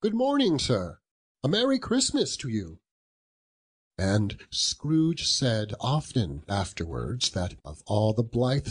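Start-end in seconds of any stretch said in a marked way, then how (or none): 0.0-2.8 high, hearty, cheery hail; good-humoured greeting. Acted. Good-humoured fellows greet Scrooge, cheery and high. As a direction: toss out a jolly greeting. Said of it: "good-humoured fellows said".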